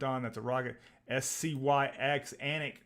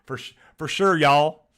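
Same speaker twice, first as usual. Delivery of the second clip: loud, higher hammed-up Texas accent; mock Texas accent; 3 semitones higher, 14 dB louder than usual. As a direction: belt it out like a proud Texan. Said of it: "a Texas accent".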